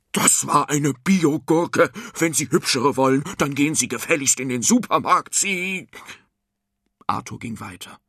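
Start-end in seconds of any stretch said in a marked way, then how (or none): 0.1-5.9 loud exasperated farmer; angry shouting. A narrator's reading. Farmer losing his temper at his customer, angry shouting. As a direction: burst out loudly, at the end of your patience. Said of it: "Er schrie".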